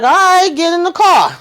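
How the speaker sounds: high-pitched mock exasperation; retold giving in. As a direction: give in as if imitating a fed-up friend. Retold grudging giving in after argument.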